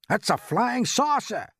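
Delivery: high, stretched-out cry of alarm; excited crowd shout. A narrator's reading. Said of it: "they shouted".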